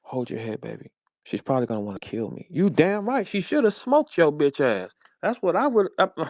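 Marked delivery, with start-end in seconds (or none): 0.0-0.9 lightly mocked smooth player; softened, husky ladies'-man voice; dropped voice. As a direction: imitate him cooing softly to a girl. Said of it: "You dropped your voice".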